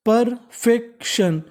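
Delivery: Perfection drawn out slowly, part by part; slow syllable by syllable. Shown as a demonstration. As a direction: model perfection slowly for the class. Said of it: "तो meaning बन गई". (धीरे-धीरे शब्द तोड़कर)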